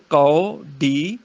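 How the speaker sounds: ਕੌਡੀ very slow, au drawn out; spelt-out au sound. Shown as a demonstration. Teacher breaking down the spelling, very slow ਕੌਡੀ. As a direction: stretch ਕੌਡੀ as ka-o-dee. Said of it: "ਕੱਕਾ ਊੜੇ ਅੰਕੜ".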